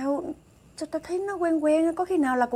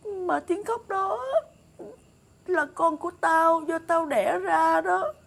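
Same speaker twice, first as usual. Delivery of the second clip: high, drawn-out, tearful lament; tearful longing; 4 semitones higher, 3 dB louder, slower than usual. Acted. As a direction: ache for a baby of your own. Yearns aloud for own child. (nghẹn ngào khao khát)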